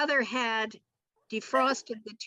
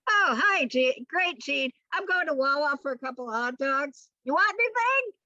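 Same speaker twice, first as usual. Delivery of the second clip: high casual cheery; airy like he's fleeing; lightly voiced breezy father; father's breezy voice; 7 semitones higher than usual.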